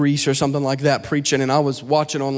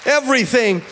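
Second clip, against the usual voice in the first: higher, sung-out preaching over music; full-force preaching; 8 semitones higher than usual. Acted. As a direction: lift the church, chant it like a climax.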